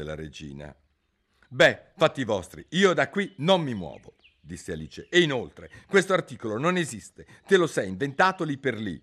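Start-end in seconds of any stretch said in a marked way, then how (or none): 1.5-9.0 put-on higher, louder argumentative girl; Alice defying the king; defiant Alice. A narrator's reading. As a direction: refuse to budge. Alice argues back.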